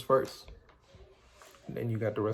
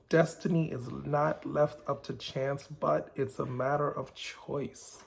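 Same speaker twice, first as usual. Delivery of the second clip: slow emphatic proverb; slower than usual. Shown as a demonstration. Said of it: "P twelve".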